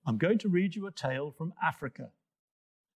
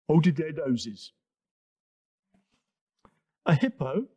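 plain reading voice

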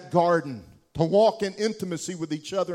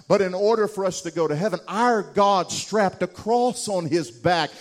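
louder, higher impassioned preaching; fervent gospel preaching, rising and louder; preaching at full force; 5 semitones higher, 6 dB louder than usual. Acted. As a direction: build the sermon to a climax.

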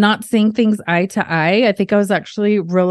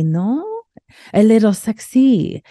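normal talking voice

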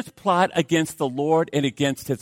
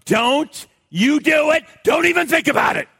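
high, loud mock warning shout; mother warning a toddler, shouting; warning yell; 9 semitones higher, 5 dB louder than usual. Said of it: "And Christy goes".

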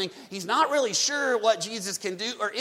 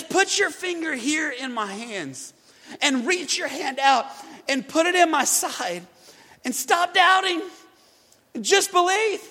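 rising plea; 5 semitones higher, 5 dB louder than usual. Acted. Jesus invites doubting Thomas.

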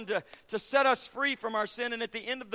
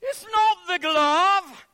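high, loud, slow mocking taunt; scornful kid calling out; cheeky English boy; 10 semitones higher, 11 dB louder, slower than usual. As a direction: play the know-it-all schoolboy. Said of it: "one of the boys ... said".

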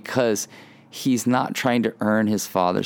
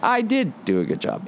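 put-on much higher, smug little kid voice; proud child; 11 semitones higher than usual. Child answering a parent's praise, mock proud kid voice. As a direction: play the self-satisfied child basking in praise. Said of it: "they're feeling like".

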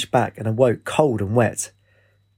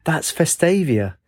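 slow, higher delighted exclamation; exclaiming; 6 semitones higher, slower than usual. A narrator's reading. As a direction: say it drawn out with wonder. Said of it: "Katie put her hands together and exclaimed".

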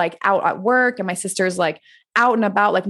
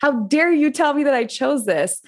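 retold angry outburst; 7 semitones higher than usual. Teen lashing out at her mentor, retold angry. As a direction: snap back at her, indignant and offended. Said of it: "I was pissed".

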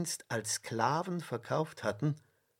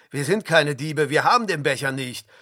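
loud, fast, heated protest; outraged, hurried challenge; indignant; 5 semitones higher, 8 dB louder, faster than usual. A narrator's reading. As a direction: deny it forcefully, building higher. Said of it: "riefen die Brüder empört".

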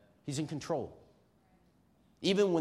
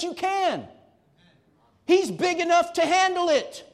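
higher, louder, rising preaching; fiery preaching; 9 semitones higher, 9 dB louder than usual. Acted. Pastor confessing his anger at God, fiery preaching. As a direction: build the fire of the sermon.

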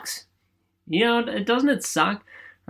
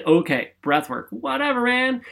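dismissive skeptic; 7 semitones higher than usual. Retold or imitated.

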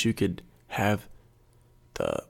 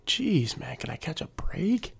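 higher, softer complaint; mock exasperation; 7 semitones higher, 4 dB quieter, slower than usual. Batter hearing his bad stats announced, exasperated. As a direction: plead under the breath, high-pitched.

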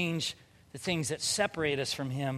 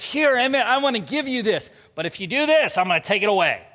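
lightly voiced bossy little girl, higher and louder; imitating young daughter; 6 semitones higher, 8 dB louder than usual. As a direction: mimic a child laying down terms to a sibling. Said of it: "And she goes".

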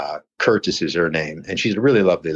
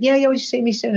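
much higher, sing-song mock gush; mock ex's brush-off. The ex ending the phone call, in a much higher mock voice. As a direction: toss off the goodbye in her fake-sweet voice. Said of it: "I said".

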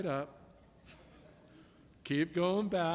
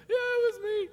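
put-on much higher, small child's admission; a little girl owning up, higher. Little girl confessing to the police officer, high voice. Girl's reply imitated.

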